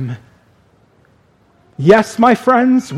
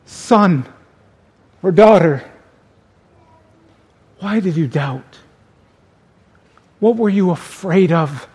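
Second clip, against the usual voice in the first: tender plea. Shown as a demonstration. Jesus to son or daughter who doubted, tender plea. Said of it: "more of a plea".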